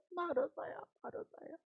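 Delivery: soft, then almost whispered; earnest plea, fading. Retold or imitated. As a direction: call her name gently, letting it die away. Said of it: "두 번 얘기하는 것은 간곡한 거예요".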